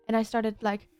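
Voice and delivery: ordinary talk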